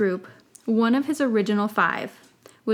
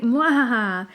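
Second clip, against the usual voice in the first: mad scientist; 3 semitones higher, 5 dB louder, slower than usual. Acted. Harry's evil laugh.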